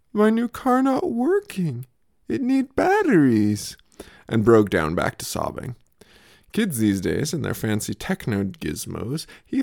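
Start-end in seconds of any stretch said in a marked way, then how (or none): 0.1-3.8 much higher, slow, wobbly mock toddler lament; tearful toddler. Toddler telling parents his car bed won't drive, mock tearful. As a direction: whimper it like a heartbroken toddler. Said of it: "he said to us tearfully".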